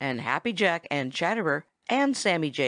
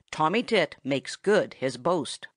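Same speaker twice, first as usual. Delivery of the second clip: ordinary delivery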